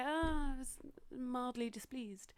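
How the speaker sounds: slow, dry underwhelmed; flat let-down tone; deadpan disappointment. Retold or imitated. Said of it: "and then I watch it and go".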